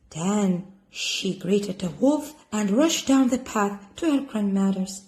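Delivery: ordinary delivery, nothing marked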